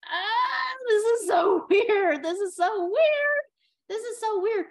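loud, high, laughing disbelief; slow, loud, high-pitched cry of bewildered delight; flustered exclaiming. Retold or imitated. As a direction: blurt it out, half laughing, overwhelmed by the note. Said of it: "and you're like".

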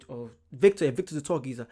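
normal talking voice

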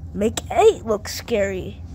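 ordinary delivery

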